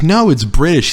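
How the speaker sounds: clumsy mock-British delivery; poor British accent. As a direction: protest in a bad British accent. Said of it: "along with my poor accents".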